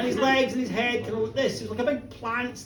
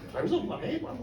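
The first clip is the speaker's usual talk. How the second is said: ordinary delivery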